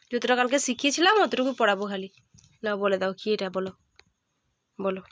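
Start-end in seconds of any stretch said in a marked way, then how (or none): 2.6-5.0 mother softly coaxing her son. Real, not acted. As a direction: coax him quietly to answer.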